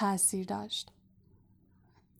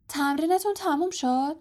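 disbelieving question, pitched higher; ناباورانه; 7 semitones higher than usual. A narrator's reading. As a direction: ask it as if you can't believe it. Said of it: "رون ناباوره گفت". (با ناباوری و تعجب)

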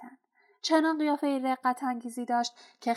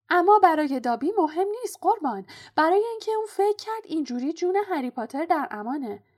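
high, loud, eager elf voice; Dobby the house-elf; 8 semitones higher, 6 dB louder, faster than usual. A narrator's reading. House-elf admitting to Harry he blocked the gate, eager confession. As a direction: confess readily in a raised, higher servant voice.

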